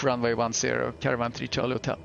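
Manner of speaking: usual speaking voice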